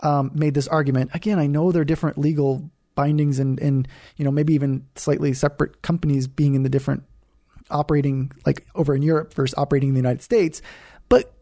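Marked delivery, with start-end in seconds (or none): none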